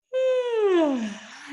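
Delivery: a long, high, open sigh out of the mouth; demo sigh. Shown as a demonstration. Teacher showing the class how to sigh, long open sigh. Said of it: "either yawn or sigh out of the mouth".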